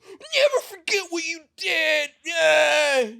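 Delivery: hammed-up taunting shout; mock troll yell. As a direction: jeer at him like an online troll. Mocking internet troll yelling.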